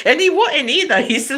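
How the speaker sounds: ordinary talk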